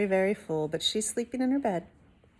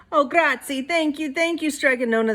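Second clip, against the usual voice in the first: grateful cry; 6 semitones higher, 4 dB louder than usual. A narrator's reading. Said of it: "the people cried".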